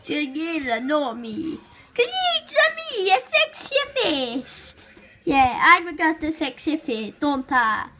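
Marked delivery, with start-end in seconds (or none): 1.9-4.7 higher, fast Mario brag; a hint of Mario showing off. Kid as Mario boasting, higher Mario voice.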